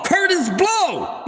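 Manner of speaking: hype shout-out. Real, not acted. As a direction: announce the name like an MC. Calling out a name as a shout-out.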